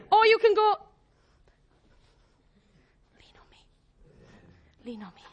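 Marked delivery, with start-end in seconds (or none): 3.2-5.2 barely audible, hushed offer; whispered reassurance. Acted. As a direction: whisper it very softly as gentle support. Voicing Jesus quietly offering support.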